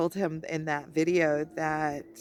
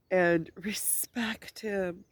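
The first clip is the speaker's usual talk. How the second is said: slow whisper, nearly gone; 4 dB quieter, much slower than usual. Real, not acted. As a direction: let it drop to a slow whisper. Emotional tribute, voice fading.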